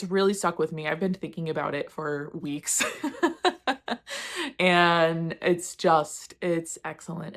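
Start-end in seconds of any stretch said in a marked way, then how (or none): 2.9-4.5 high burst of giggles; amused, high-pitched laughter; laughing. Real, not acted. Breaking into giggles over being stuck on a book, high laughter. Laughs at her own remark.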